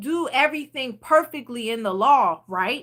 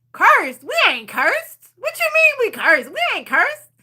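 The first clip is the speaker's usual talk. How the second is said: high, loud incredulous protest; mock-outraged denial of being cursed; indignant disbelief; 8 semitones higher, 4 dB louder than usual. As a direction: push back as if the idea is absurd. Disbelieving retort to mom.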